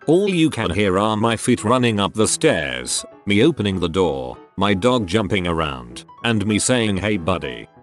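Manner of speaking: ordinary delivery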